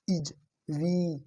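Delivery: इज with a short light i, वी with a long ee, drawn out; very slow vowel demo. Shown as a demonstration. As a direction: spell out इज and वी slowly for the class. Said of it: "हल्की sound ... वी में लंबी".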